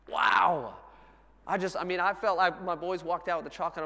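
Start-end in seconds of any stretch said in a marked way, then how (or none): 0.1-0.8 loud, raised "wow"; awed exclamation. Real, not acted. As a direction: burst out in amazement mid-sermon. Preacher marvels at grace.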